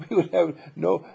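ordinary talk

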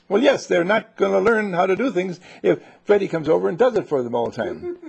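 fed-up protest to the dad; indignant complaint. Acted. Neighbor lady complains to the dad.

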